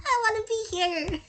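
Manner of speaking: high squeaky laugh then slow whine; tiny high nervous voice. Acted. Squeaky reaction to scary smile.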